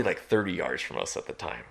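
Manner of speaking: usual speaking voice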